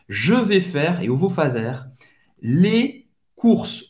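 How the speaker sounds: ordinary talk, nothing marked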